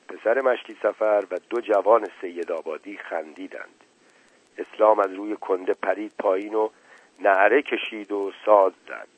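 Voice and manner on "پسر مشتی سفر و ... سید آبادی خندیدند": usual speaking voice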